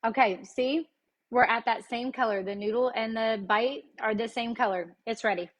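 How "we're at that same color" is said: ordinary delivery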